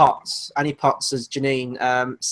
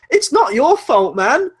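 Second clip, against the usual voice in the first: pat the usher on the head with your voice; mock reassuring; 9 semitones higher, 4 dB louder than usual. Consoling the sad usher.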